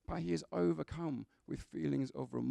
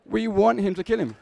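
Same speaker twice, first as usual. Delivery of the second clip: loud, higher-pitched playful exclamation; mock rallying cry; 6 semitones higher, 12 dB louder than usual. Preacher cheering on the film hero, in a loud mock-eager cry. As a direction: cry it out like an excited film fan. Comic climax after film quote.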